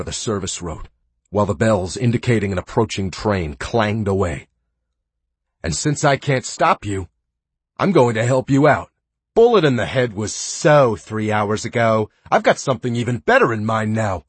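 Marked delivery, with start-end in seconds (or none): 5.6-14.2 high, loud gleeful threat; cruel glee. Acted. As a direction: announce the plan like a treat. Captor reveals train plan.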